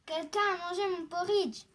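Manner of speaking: mildly angry exclamation, loud and indignant; big angry bear. A narrator's reading. Big bear finding his porridge eaten, mildly angry. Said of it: "dit de sa grosse voix en colère".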